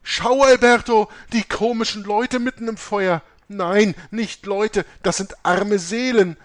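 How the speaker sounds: dying mother screaming at visions; terrified screaming. A narrator's reading. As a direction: beg for rescue from the fire, screaming. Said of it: "Aber die Mutter schrie weiter".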